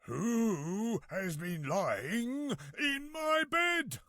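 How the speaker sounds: slow, high, drawn-out roar; roaring papa bear. A narrator's reading. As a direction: roar it out slowly, building in outrage. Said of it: "roared Daddy Bear".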